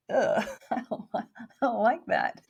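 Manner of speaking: grossed out, laughing it off; laughing disgust. Retold or imitated. Child dislikes her adult voice.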